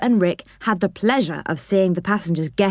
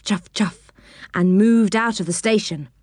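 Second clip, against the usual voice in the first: ordinary delivery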